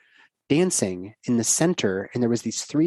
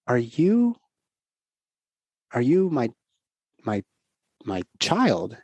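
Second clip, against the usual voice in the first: wondering question; slower than usual. Retold or imitated. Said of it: "I realized".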